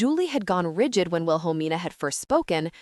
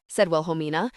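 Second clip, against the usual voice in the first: ordinary delivery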